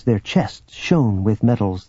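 plain reading voice

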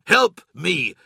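loud snarled command. A narrator's reading. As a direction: snarl it through your teeth. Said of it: "Loken snarled again".

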